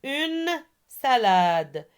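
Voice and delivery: UNE SALADE slowly, each word drawn out; slow model for repetition. Shown as a demonstration. Teacher gives pupils a word to repeat, slowly drawn out. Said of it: "On répète".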